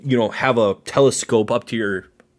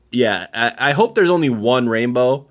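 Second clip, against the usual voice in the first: ordinary talk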